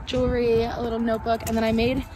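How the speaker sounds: high, bubbly show-and-tell voice; chirpy basket reveal; bubbly sorority girl. Acted. Showing gift basket items.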